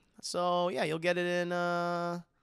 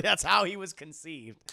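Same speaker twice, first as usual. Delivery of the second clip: ordinary delivery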